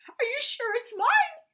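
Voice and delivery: high, slow, squeaky little voice; squeaked excitedly. Acted. Timid Piglet asks Pooh about his stick, squeaky and excited. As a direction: squeak it, hardly daring to hope. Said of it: "squeaked Piglet excitedly".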